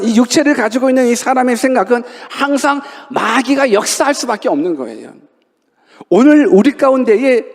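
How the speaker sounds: normal talking voice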